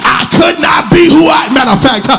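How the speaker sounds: sung, shouted whoop over the organ; preaching at full force. Acted. Pastor lifting the congregation in full whoop. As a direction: preach it home, rising to the peak.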